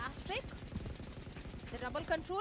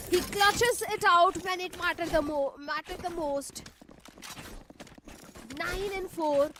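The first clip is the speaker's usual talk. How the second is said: esports caster; 7 dB louder than usual. Real, not acted. Caster hypes player.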